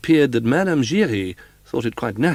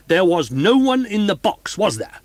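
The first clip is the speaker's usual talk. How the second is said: roaring manager; 10 semitones higher, 7 dB louder than usual. A narrator's reading. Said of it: "roared Richard".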